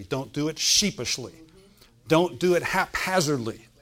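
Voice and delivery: normal talking voice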